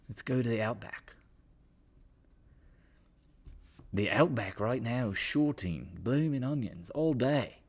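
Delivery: hammed-up Australian commercial voice; Crocodile Dundee Australian accent. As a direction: sell it like an Aussie steakhouse ad. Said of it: "the Crocodile Dundee voice".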